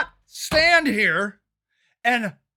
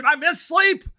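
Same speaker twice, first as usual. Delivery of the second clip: ordinary talk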